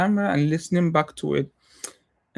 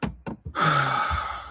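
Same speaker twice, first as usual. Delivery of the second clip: long, heavy exhale; long sigh; 4 dB quieter than usual. Real, not acted. Speaker pauses after venting about self-consciousness, long sigh. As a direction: let out a drawn-out sigh.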